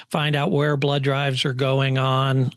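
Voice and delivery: usual speaking voice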